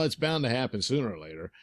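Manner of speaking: ordinary delivery, nothing marked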